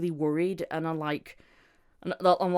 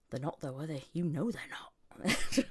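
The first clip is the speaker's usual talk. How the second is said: soft, lightly voiced inner doubter; quietly undercut it, like a nagging thought; doubting inner voice; 7 dB quieter than usual. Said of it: "part of me says".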